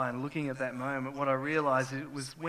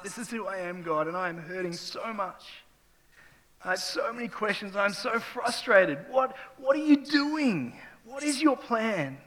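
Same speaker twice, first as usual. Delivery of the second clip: hurting plea to God; 6 semitones higher, 5 dB louder than usual. Retold or imitated. Grieving father pleads with God, hurt and frustrated. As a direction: ask God why, aching and worn out. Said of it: "so emotionally loaded".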